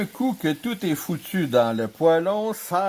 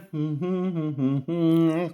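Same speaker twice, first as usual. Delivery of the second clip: drawn-out humming chuckle; gleeful chuckle. Real, not acted. Cook facing the supreme test, long humming chuckle. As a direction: chuckle through closed lips in anticipation. Relishing the taste test ahead.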